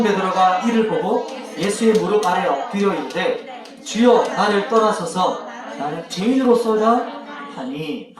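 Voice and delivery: solemn Bible recitation, slow and loud; scripture read aloud together with the kids; slow group reading. Shown as a demonstration. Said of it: "같이 한번 읽어봅시다".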